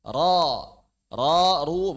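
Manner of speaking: loud, heavy "Ro" with a darkened vowel; correct thick ro. Shown as a demonstration. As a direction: thicken "Ro", never a thin A. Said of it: "bukan ra". ("Ro" tebal dan benar)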